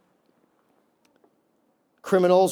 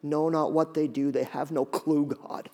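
soft, lower, quiet plea; calm forgiveness; 4 semitones lower, 6 dB quieter than usual. Acted. Jesus asks the Father to forgive, soft calm prayer. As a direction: pray it gently and softly.

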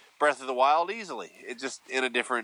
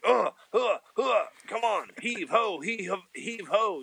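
straining grunts; 8 semitones higher, slower than usual. Retold or imitated. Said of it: "I'm like".

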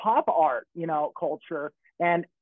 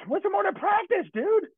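pitched way up in disbelief, practice stretched; incredulous; 12 semitones higher than usual. Retold or imitated. Telling a friend about the mentor's modest words, high incredulous squeak. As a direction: squeak out the question like it's absurd.

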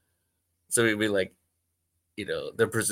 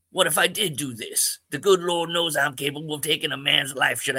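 [name]; 6 semitones higher, 4 dB louder than usual. Acted. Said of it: "[name]".

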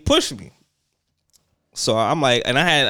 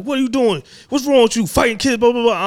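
higher, louder mock principal telling him off; principal yelling; 10 semitones higher, 4 dB louder than usual. The principal scolding him for fighting, a high mock yell. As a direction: bark it like an outraged principal. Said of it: "So he yelling at me".

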